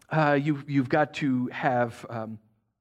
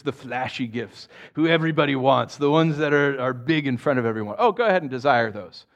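sarcastic go-ahead, lingering scornfully over the list; 2 semitones lower than usual. Shown as a demonstration. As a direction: mockingly wave them on. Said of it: "I think he's being sarcastic".

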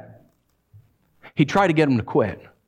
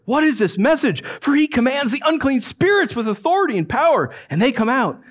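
amazed crowd; 8 semitones higher than usual. A narrator's reading.

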